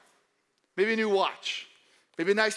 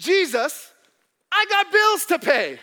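mock whiny complaint; 7 semitones higher, 7 dB louder than usual. Pastor voicing complaining congregant.

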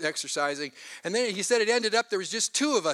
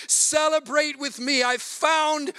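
joyful call; 7 semitones higher, 3 dB louder, slower than usual. Acted. Shepherd calls friends to celebrate.

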